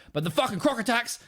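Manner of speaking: high-pitched mock outburst; sudden high, ranting impression; losing his shit. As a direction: imitate a man suddenly losing it. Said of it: "He just, like, loses his shit".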